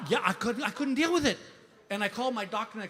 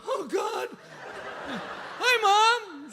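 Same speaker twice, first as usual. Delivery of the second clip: high-pitched panic; 10 semitones higher, much slower than usual. Retold or imitated. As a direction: squeak out a panicked hello. Mom calls while he's high.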